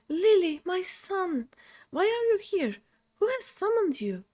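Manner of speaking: a father's spirit, amazed, calling his son by name; ghostly parent. Acted. Reads NPC dialogue aloud.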